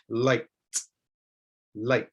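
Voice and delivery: light with a crisp T ending; ending T sound. Shown as a demonstration. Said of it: "I'm adding almost a T sound".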